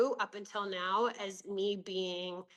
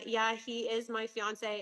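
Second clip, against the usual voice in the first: normal talking voice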